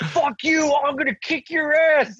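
high, hammed-up threat; mock angry threat. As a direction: ham up the attacker's threat. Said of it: "And he's like".

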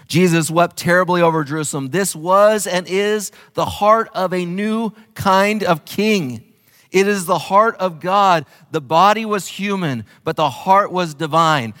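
preaching the quote with fervour; impassioned preaching. Acted. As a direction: lift it up with passion. Quoting Hughes, preaching.

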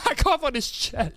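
words spilling out through laughter; laughing. Real, not acted. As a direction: laugh while admitting it. Retelling his own embarrassing cough.

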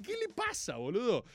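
incredulous exclamation. Retold or imitated. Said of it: "Y decís".

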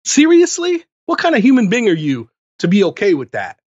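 incredulous rant. Real, not acted. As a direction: demand an answer, voice climbing. Ranting at forgiving fans.

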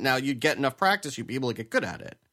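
usual speaking voice

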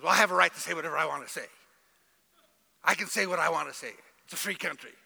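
proud entitled attitude. Retold or imitated. Pastor imitating proud citizens, fast and defiant. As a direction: rattle it off like a proud know-it-all. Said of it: "have this attitude that says".

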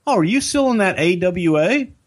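high, casual cop question; innocently asking; lightly voiced cop; second cop. Second cop asks in story.

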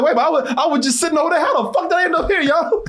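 high, fast, bewildered; baffled victim. Retold or imitated. Voicing the dazed guard to a friend, high fast baffled. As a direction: rattle it off high and confused, as if dazed. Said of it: "He's like".

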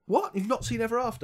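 high-pitched disbelief; astonished. Real, not acted. Co-host hears the film is unseen, astonished. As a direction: gasp it as a shocked question. Shocked co-host has not seen it.

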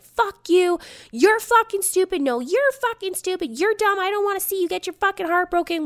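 high-pitched retold screaming match; screaming argument. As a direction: act out a heated back-and-forth yell. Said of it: "Jackie and I are screaming".

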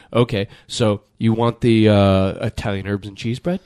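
mock server asking which bread; Subway server. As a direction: drag out the bread question like a bored server. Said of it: "they're like".

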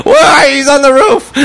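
high mock holler; shrieky, hammed-up shouting; retold girl shouting. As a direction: imitate a bewildered girl yelling out. Imitates girl shouting, searching for him.

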